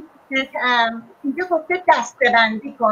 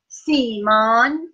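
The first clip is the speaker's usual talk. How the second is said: سیمان stretched out very slowly; slow, one by one; much slower than usual. Shown as a demonstration. Teacher showing a pupil how to dictate slowly. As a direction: say it slowly so they can write it. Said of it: "یکی یکی بگو بنویسیم".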